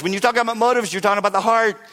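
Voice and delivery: ordinary talk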